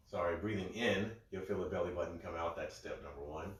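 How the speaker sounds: ordinary delivery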